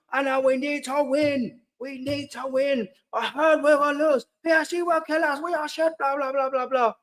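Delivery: high, whiny mock of a commenter; mocking sarcastic voice. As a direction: sneer it out in a silly high voice. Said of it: "you're saying that sarcastically".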